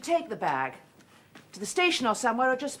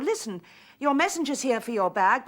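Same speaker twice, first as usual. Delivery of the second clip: normal talking voice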